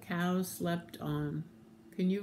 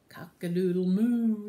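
loud cow call; cow's crow; 7 dB louder than usual. Acted. Cow crowing to the ducks, loudly. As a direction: belt out the crow with a moo. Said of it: "then the cow went".

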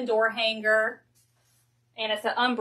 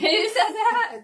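laughing; 10 semitones higher, 5 dB louder, slower than usual. Real, not acted. Laughing at a viewer's comment.

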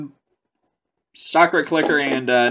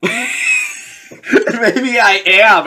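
laughing through the words, very slow; 8 semitones higher, 3 dB louder, much slower than usual. Real, not acted. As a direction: let the laugh carry the line. Laughing at own expression.

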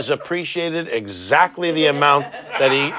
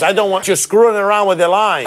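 put-on Cuban-accented angry yell; angry shout; 7 semitones higher, 6 dB louder than usual. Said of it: "started screaming at me".

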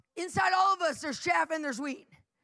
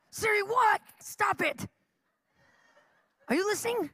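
mock scolding Siri; 7 semitones higher than usual. Real, not acted. Preacher scolds his phone mid-sermon, mock irritated. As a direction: tell the phone off, half joking.

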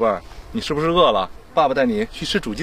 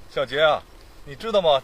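ordinary talk, nothing marked

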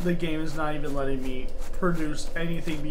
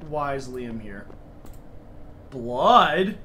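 normal talking voice

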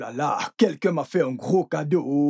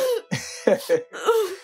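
evil laugh. Acted. Villain gloats at captive.

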